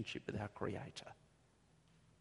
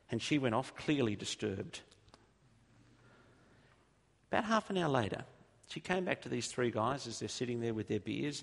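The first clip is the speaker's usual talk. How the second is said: ordinary talk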